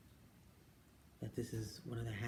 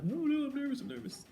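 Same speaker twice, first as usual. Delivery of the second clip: much higher, fretful cry; nervous whine; 13 semitones higher, 5 dB louder than usual. Retold or imitated. Answering mom before the driving test, high nervous whine. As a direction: whimper the nerves out. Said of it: "I'm like".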